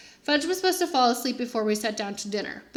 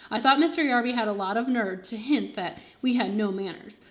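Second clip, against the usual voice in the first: ordinary delivery, nothing marked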